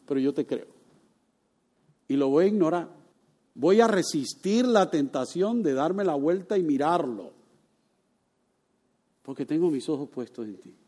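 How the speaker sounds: hushed, trusting prayer to God; quiet prayer. Pretend. Preacher models a believer praying to God, soft trusting prayer. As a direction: pray it softly, like handing it over. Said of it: "Dígale".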